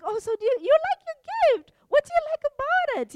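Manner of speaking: playful fake-innocent chirp; eager. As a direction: feign innocence, bursting with eagerness. Secret Santa probing eagerly.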